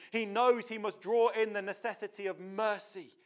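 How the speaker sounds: ordinary talk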